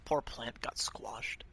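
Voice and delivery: ASMR whisper. Pretend. Soft whisper in ASMR bit.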